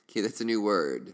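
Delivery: normal talking voice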